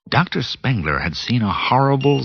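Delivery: ordinary delivery, nothing marked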